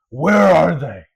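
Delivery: big loud roar of challenge; acted-out strongman bellow. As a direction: roar it out, ready for a fight. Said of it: "he roars and he says".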